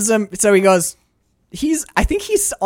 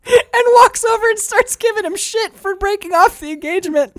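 real high-pitched laughing delivery; squeaky with laughter; laughing narration; 14 semitones higher than usual. Real, not acted. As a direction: tell it through your laughter. Retelling while cracking up.

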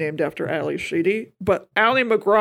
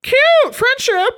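very high, slow, sing-song cute friendship; sarcastic coo; 7 dB louder, much slower than usual. Real, not acted. Teasing cohost about the directors in a high mocking coo.

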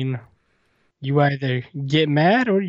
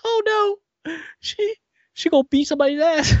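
squeaky high, laughing alarm; 14 semitones higher than usual. Retold or imitated. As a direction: go way up, half laughing. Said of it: "I was like".

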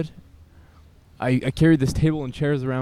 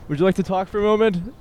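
much higher and a bit faster, calling to strangers; high, bright street call; 8 semitones higher, 4 dB louder, faster than usual. Real, not acted.

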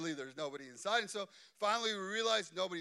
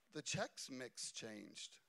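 imitating son; 6 semitones lower, 10 dB quieter, much slower than usual. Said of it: "And he goes".

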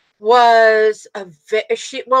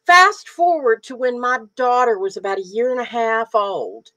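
ordinary delivery

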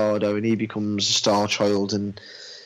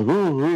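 high, louder ape "ooh ooh"; imitated ape hooting; 6 semitones higher, 4 dB louder than usual. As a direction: hoot like a curious ape. Retelling apes meeting the monolith.

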